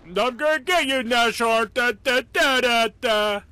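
loud, high mock battle cry; mock attacker. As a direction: imitate an eager gunner, high and loud. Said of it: "He's like".

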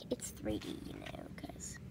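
soft whispered aside; hushed unvoiced aside. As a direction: drop to a whisper as if sharing a secret. Aside about the 3D bookmark.

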